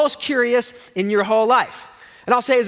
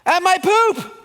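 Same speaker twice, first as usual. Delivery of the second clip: put-on high, loud child's voice that drops at the end; high excited kid voice fading into a laugh; amazed little boy; 9 semitones higher, 3 dB louder, slower than usual. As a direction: sound like a four-year-old showing off. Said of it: "He goes".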